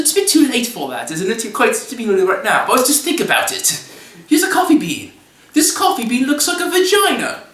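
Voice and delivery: high-pitched British impression; James Hoffman. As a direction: lecture in a hammed-up, high British voice. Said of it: "comes to me in his British voice".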